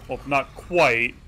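ordinary talk, nothing marked

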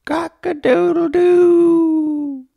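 long, high, slow cock-a-doodle-doo; rooster crowing. A narrator's reading. Rooster greeting the sun to wake the farmer, crowing. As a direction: crow it out like a rooster at sunrise. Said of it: "the rooster crowed".

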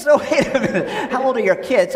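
ordinary delivery

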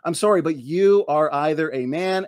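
normal talking voice

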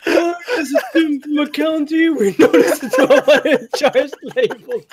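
laughing through it. Acted. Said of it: "I had, like, a whole accent".

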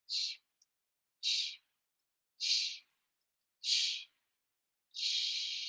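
gentle shushing; leaves falling shush. Shown as a demonstration. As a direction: hush out like leaves on a roof. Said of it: "just like this".

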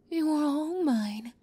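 normal talking voice

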